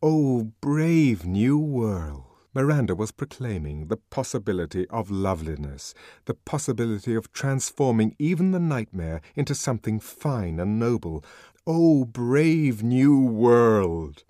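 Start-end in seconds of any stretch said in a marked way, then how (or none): 0.0-2.2 very slow, ringing proclamation; stirring, stretched-out declaration; call to arms. A narrator's reading. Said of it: "they trumpeted a call to arms". 11.7-14.2 loud, very slow proclamation; ringing, long-drawn declaration; challenge, a command. A narrator's reading. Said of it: "It was a challenge, a command".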